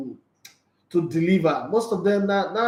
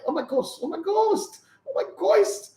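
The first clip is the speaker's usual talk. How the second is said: much higher mock girly squeal, oh my ghost; mocking fake Nigerian girls. Host mocking fake Nigerian girls on air, much higher squeal. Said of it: "fake Nigerian girls".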